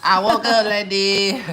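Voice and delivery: drawn-out, high, loud crowing; playful boasting. Pretend. Man showing off his crown, stretched-out boastful holler. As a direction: belt the brag slowly and high. Repeats the brag louder, drawn out.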